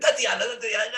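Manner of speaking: high, crooning call of her name; leering boss. Retold or imitated. Boss Vlad calling his secretary in a high leering croon.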